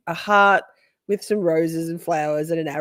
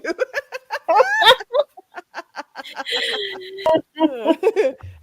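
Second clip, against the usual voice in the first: long high fit of laughing; helpless laughter; 11 semitones higher than usual. Real, not acted. Cracking up at friend's message, helpless laughter. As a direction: lose it completely in giggles.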